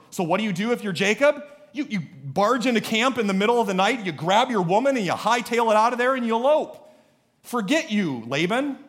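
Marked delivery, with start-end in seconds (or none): 1.7-8.8 higher, louder, building escape plan; fired-up, indignant outburst; worked-up defiance. Acted. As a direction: rattle it off as a defiant Jacob. Acts out Jacob's defiant escape.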